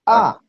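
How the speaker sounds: "A" falling quickly from high; short, abrupt falling "A"; fourth tone. Shown as a demonstration. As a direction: drop "A" sharply and keep it short. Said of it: "Otra vez desde el inicio".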